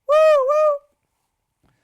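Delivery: high, loud "woo woo" hoot; piercing mock train whistle; train whistle imitation. Imitates train whistle after theme song.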